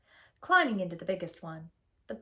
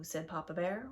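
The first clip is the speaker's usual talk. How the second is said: plain reading voice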